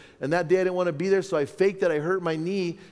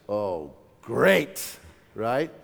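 retold dismay; 7 semitones lower, much slower than usual. Dismay at learning he's father.

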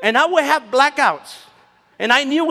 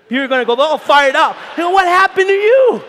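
fired-up shout; 5 semitones higher, 5 dB louder than usual. Retold or imitated. Going back fired up.

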